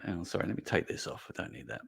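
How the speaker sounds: muttered off-mic aside while taking something off; half-turned away, barely voiced; quiet aside. Real, not acted.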